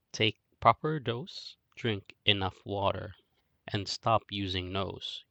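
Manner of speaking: usual speaking voice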